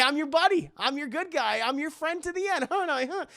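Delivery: high, singsong acted reassurance; butter the kid up; coaxing fake friendliness. Chucky winning Tyler back, coaxing fake friendliness. Chucky coaxes the boy, retold.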